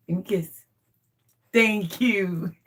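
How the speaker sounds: ordinary delivery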